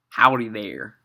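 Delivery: cowboy drawl, Western accent greeting; country Western accent. Shown as a demonstration. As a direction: play a folksy Western cowboy with a twang. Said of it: "I wanted to do a country Western accent".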